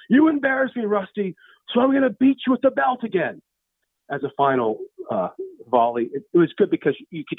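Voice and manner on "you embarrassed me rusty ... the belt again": high, raised-voice threat; really loud scolding. Acted. Tyler scolding Rusty as they leave, really loud. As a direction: scold him loudly for everyone to hear. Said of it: "he said really loudly".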